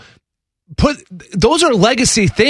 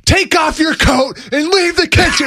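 high, sharp mock chef tirade; imitating a furious kitchen boss; chef berating a cook; 10 semitones higher than usual. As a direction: bark it at a cook like a TV chef tearing into him. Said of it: "he's just laying into some poor guy".

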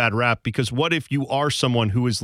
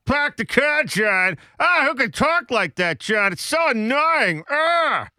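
hammed-up, high Boston whine; mock a griping Bostonian; exaggerated Boston accent, pitched way up; 9 semitones higher, slower than usual. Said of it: "someone from Boston saying".

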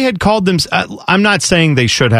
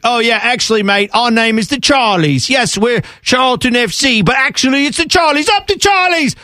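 lightly done English football fan, higher and cheery; a hint of a London fan chanting his club; mock English fan; 8 semitones higher than usual. Host mimicking English supporters, light English fan accent. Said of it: "and then they're like".

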